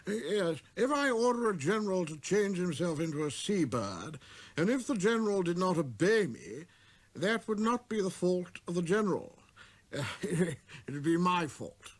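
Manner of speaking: high, explaining royal voice with a laugh; the king. Acted. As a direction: reason it out grandly.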